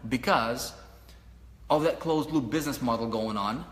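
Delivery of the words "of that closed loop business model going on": ordinary talk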